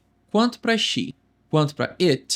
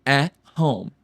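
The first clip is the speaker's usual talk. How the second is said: You were at home bem lento; devagar; 2 semitones lower, much slower than usual. Shown as a demonstration. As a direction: articule pausado para o aluno. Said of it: "Como eu digo, você estava em casa". (You were at home, lento, para repetir)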